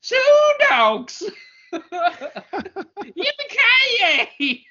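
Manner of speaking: put-on high, laughing old miner whoop; old prospector. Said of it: "all of a sudden just became an old miner".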